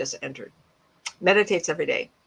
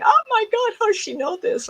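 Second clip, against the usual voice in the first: excited scream. Retold or imitated. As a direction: shriek it out in amazement. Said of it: "people were screaming and yelling at me".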